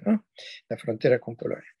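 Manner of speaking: ordinary delivery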